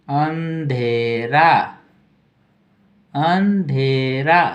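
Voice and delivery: अंधेरा drawn out syllable by syllable; slow dictation. Shown as a demonstration. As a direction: dictate अंधेरा slowly for writing. Teacher dictating word slowly. (धीरे धीरे लिखवाते हुए)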